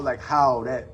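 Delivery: ordinary talk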